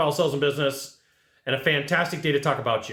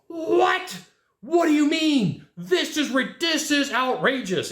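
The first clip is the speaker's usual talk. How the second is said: fake freak out; 11 semitones higher, slower than usual. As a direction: squeal it like a client putting on a tantrum. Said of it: "And this guy freaks out".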